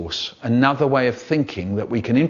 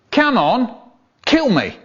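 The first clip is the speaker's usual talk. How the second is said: higher, louder pushy bloke; mock bloke; 9 semitones higher, 4 dB louder, slower than usual. Stranger on the train urging the poet, in a mock voice. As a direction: egg him on. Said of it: "this bloke turned to me and said".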